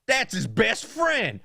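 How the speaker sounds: put-on slow, high-pitched old-man voice; blurting grandpa. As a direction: imitate grandpa announcing it to strangers. Grandpa blurting to stranger, imitated.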